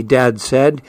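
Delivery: ordinary delivery, nothing marked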